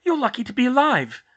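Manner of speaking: high, loud, astonished matron; shocked amazement. A narrator's reading. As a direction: exclaim at a narrow escape.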